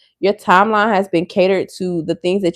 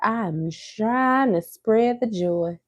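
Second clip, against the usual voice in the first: mock sweet; 3 semitones higher, 4 dB quieter, much slower than usual. Mock sweet self-affirmation.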